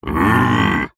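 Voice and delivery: short high roaring growl; sudden roar. Acted. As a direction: let out a beastly roar. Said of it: "Внезапный рёв".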